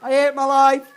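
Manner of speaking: high, loud hammed-up lament; mock despair. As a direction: keep wailing the fake tragedy, laughing through it.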